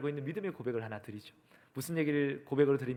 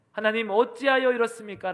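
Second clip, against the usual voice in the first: louder, higher plea to God; acted cry of protest, raised voice; 7 semitones higher, 7 dB louder than usual. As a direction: cry out the question as Job. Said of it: "욥이 소리치는 이야기들입니다".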